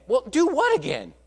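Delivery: high-pitched, puzzled challenge; incredulous. Retold or imitated. Leader answering a kid who wants to redo it, incredulous. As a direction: question it in mock disbelief.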